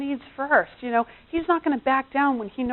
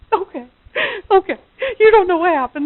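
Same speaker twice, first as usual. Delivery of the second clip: mock pent-up outburst, high and louder; venting outburst; 8 semitones higher, 8 dB louder than usual. Wife giving in and venting to husband, mock outburst. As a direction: play at letting it all out.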